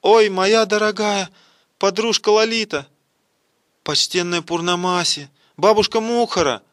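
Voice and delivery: performed shriek of astonished recognition; startled cry. A narrator's reading. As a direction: cry out each name in joyful shock. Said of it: "вскрикнула от неожиданности".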